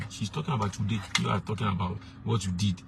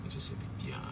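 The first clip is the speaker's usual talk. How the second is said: whispered aside; 13 dB quieter than usual. Shown as a demonstration. Comic hushed aside on taboo word.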